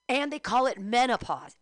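ordinary talk

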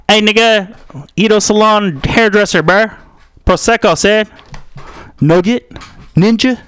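high, interrupting bro voice; heckling bro. Acted. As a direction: butt in as a pushy bro.

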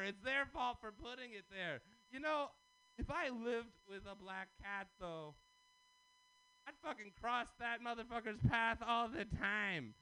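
normal talking voice

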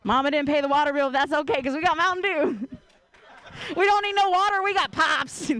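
put-on light country accent, punching up on we don't need no water; a hint of a Southern country accent. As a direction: play the proud country kid, lightly. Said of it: "in a country accent".

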